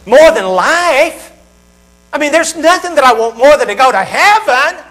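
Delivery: rising to an eager cry, slow at first; eager listener. Retold or imitated. Listener quoted by preacher, growing into an eager loud cry. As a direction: voice a believer who wants heaven badly. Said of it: "You say".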